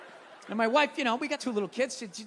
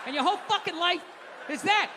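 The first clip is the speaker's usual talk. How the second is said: ordinary delivery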